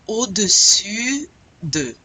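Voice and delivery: slow, loud "Au-dessus de" with a held rounded u; teacher modelling the French u in "Au-dessus de"; clear French U. Shown as a demonstration. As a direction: stretch the u of "dessus", lips rounded, in "Au-dessus de". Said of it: "very important that you get this".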